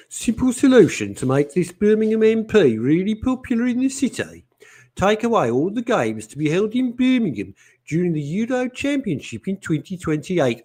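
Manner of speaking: lightly voiced commenter reading her own message; imagined Leslie voice. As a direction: read the comment as you imagine she sounds. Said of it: "I wonder how Leslie speaks".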